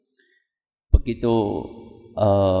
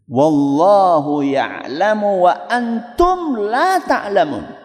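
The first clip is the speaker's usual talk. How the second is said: slow, drawn-out verse recitation; Quran recitation; much slower than usual. Real, not acted. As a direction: recite the verse slowly and solemnly. (melantunkan ayat dengan pelan dan panjang)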